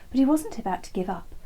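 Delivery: plain reading voice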